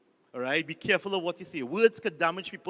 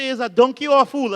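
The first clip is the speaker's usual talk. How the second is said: loud, higher exasperated question; exasperated yell; 5 semitones higher, 10 dB louder than usual. Retold or imitated. Fed-up interviewer yells at the foolish applicant. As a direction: bellow it in disbelief.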